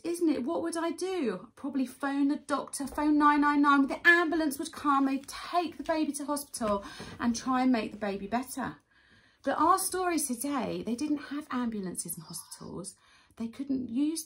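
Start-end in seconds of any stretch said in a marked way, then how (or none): none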